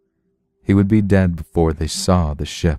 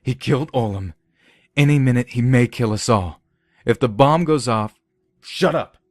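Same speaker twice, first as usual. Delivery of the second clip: urgent anger; 6 semitones higher than usual. Acted. Nelson urging them to fire.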